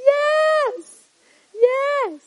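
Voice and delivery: high, loud, drawn-out shout; exultant cheer. Real, not acted. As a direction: cry out in delighted triumph. Celebrates after the laugh.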